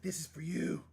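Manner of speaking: sweet, high gushing offer, half-joking; mock heartfelt gift. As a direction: hand it over like a lovesick suitor, hammed up. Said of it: "Be like".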